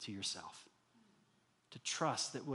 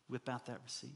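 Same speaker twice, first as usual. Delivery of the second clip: ordinary talk, nothing marked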